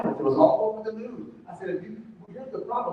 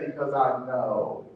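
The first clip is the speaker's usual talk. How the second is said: low and slow, certain; deep, unhurried imitation of a mentor; knowing mentor; 9 semitones lower, slower than usual. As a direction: say it like a wise old mentor who's seen it all. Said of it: "That's exactly what he said".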